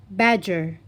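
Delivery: usual speaking voice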